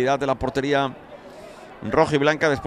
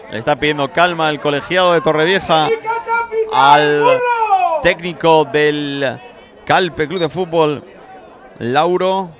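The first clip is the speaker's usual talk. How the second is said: louder, drawn out over the noise; raised commentary; 3 semitones higher, 7 dB louder, slower than usual. Real, not acted. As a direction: push the voice over the protests. Bench protest, referee calming coach.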